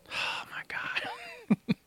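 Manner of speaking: a high, slow whisper of disbelief; whispered oh my God. Real, not acted. A real whisper after dying in the game. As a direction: breathe it out in shock.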